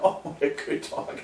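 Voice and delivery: soft breathy praise; gushing praise. Retold or imitated. Owner fawning over dog, soft half-whisper.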